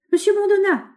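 high, loud sudden exclamation; sudden cry. A narrator's reading. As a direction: cry out all at once. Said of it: "s'écria-t-il soudain".

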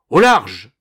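very loud, short command; shouted order. A narrator's reading. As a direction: order an intruder to stand back. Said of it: "ordonnèrent-ils". (ordre crié avec force)

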